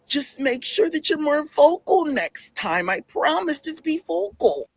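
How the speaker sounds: high, drawn-out mock coworker; put-on coworker voice. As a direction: reassure in an exaggerated high, slow voice. Coworker's reply acted in put-on high voice.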